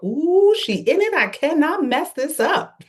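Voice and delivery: high-pitched, drawn-out quoted squeal; mock-excited high voice, stretched out; excited inner voice. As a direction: play up the thought in a high, slow voice. Acted quote of her own excited thought.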